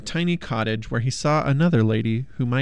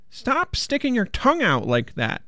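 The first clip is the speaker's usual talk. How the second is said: high, nagging princess voice; 10 semitones higher than usual. A narrator's reading. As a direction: nag him in a high voice. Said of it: "nagged the princess".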